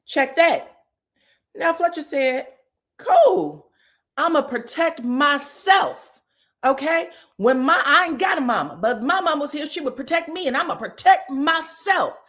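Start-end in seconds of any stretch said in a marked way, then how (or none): 3.0-12.2 defiant. Retold or imitated. Nell defiant to Wanda.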